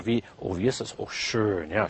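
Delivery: normal talking voice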